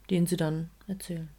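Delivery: normal talking voice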